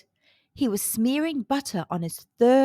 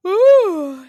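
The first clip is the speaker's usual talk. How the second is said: loud, higher drawn-out yawn; giant yawn; 8 semitones higher, 13 dB louder than usual. A narrator's reading. Giant grows sleepy at the table, big yawn. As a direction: let a huge sleepy yawn out. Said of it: "he yawned".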